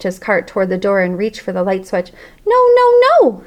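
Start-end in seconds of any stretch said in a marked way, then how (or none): 2.5-3.4 high, loud squeaking protest; squeaky panicked cry. Acted. Hamster begging the janitor not to turn off the lights, in a loud squeak. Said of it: "I squeaked".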